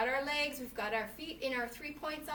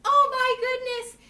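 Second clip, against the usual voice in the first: much higher and louder shocked cry; big shocked exclamation; 11 semitones higher, 10 dB louder than usual. Shown as a demonstration. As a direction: go all out gobsmacked. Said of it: "Your shocked face".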